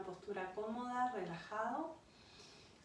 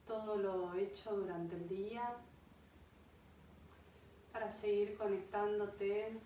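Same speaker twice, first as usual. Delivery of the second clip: unhurried meditation voice with long pauses; guided meditation. Acted. Yoga guide leads a listener to an imagined beach, guided meditation. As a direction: guide them gently into rest, phrase by phrase.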